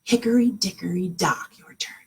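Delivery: unvoiced whisper of the rhyme. Shown as a demonstration. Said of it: "are you ready?".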